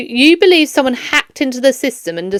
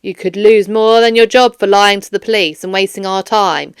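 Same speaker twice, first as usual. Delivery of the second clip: usual speaking voice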